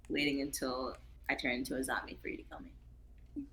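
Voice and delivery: whisper. Real, not acted. Whispered sign-off.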